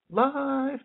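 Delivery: "life" sung very high and held. Shown as a demonstration. Teacher demonstrates "life" soaring to a high vibrating pitch. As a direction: throw "life" way up into a high ring. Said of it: "much easier to make into a higher vibrating".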